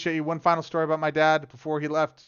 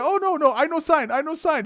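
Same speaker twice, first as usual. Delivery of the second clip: much higher, louder panicky pleading; frantic stepmother; 8 dB louder than usual. Retold or imitated. Stepmother refusing to sign the hospice order, high panic. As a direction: squeal the refusal in broken English. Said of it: "my stepmother is sitting there".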